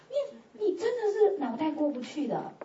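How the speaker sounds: ordinary delivery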